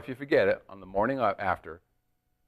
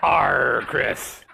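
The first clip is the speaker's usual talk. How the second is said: pirate voice; 3 semitones lower, slower than usual. Acted. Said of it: "National Speak Like a Pirate Day".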